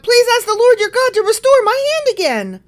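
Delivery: high, loud desperate plea; crying out. Acted. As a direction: cry out pleading for help. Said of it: "The king cried out to the man".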